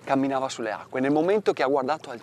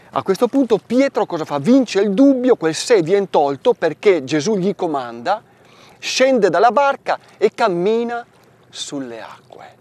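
rising fervor; 6 semitones higher, 10 dB louder than usual. Acted. Preaching Peter's triumph.

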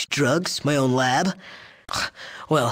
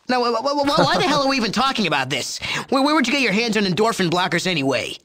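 flustered rant; 8 semitones higher, faster than usual. Acted. Flustered rant, fast and higher.

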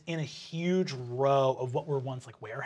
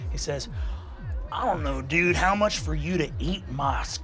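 jaded bouncer. Acted. Bouncer to rich kids wanting in, scornful. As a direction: throw the question back, mocking and profane.

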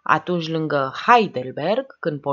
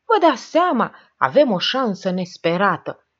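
starts high and loud, overexcited; frenzied excitement; 4 semitones higher, 5 dB louder than usual. A narrator's reading. Oprișan realises an unhoped-for chance, frenzied excitement. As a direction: blurt out the sudden hope. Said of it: "bâlbâi Oprișan cu spume la gură".

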